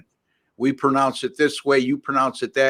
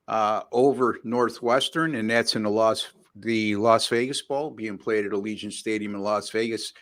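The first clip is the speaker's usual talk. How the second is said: ordinary talk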